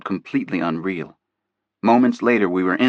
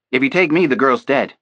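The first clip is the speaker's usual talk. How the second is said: loud, menacing warning; cold threat; 5 semitones higher, 6 dB louder than usual. A narrator's reading. Kidnapper's contact man warns the FBI agent, cold threat. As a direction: threaten them flatly with the girl's life.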